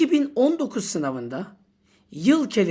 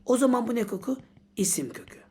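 ordinary talk, nothing marked

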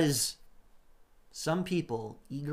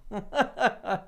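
high bright chuckle; real chuckle; amused laugh; 8 semitones higher than usual. Real, not acted. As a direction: laugh at the rascal. Amused at the rascal.

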